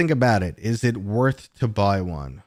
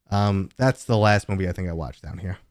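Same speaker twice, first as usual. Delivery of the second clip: ordinary talk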